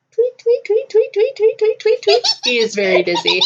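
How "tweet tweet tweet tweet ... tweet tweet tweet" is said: very high, chirpy cartoon birds; tweeting birds. Little birds circling his dizzy head, high tweeting. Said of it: "there's, like, little birds circling his head".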